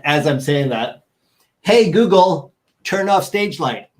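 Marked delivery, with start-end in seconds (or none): 1.6-3.9 raised, higher voice calling the smart speaker; voice command. Real, not acted. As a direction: call out an order to the assistant.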